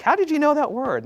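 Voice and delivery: high-pitched incredulous teacher question; amazed questioning. Retold or imitated. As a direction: play up astonishment at a pupil. Teacher questioning kids, acted out.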